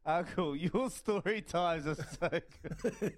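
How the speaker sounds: slow, through real laughter; laughing praise. Real, not acted. Co-host praising uncle's story time, laughing. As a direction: chuckle the words out.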